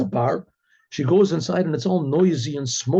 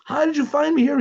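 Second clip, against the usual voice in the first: retold yell; 11 semitones higher than usual. Card player spots his wife in the bar, retold yell. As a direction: call out high in startled disbelief, stretching the words. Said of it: "yells out".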